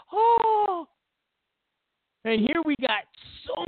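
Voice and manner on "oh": mock gasp. Mock gasp after Brady line.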